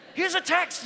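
high mock-Aussie announcement; terrible Australian accent. Preacher showing off a Bible text, bad Australian accent. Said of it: "That's a terrible accent".

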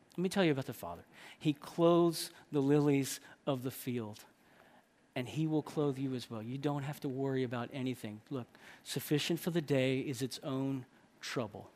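low, soft reassurance; comfort. Acted. Jesus telling them not to be anxious, quiet comforting. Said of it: "There's comfort".

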